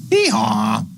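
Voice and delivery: mock donkey hee-haw; donkey bray. Donkey sound after spelling donkey.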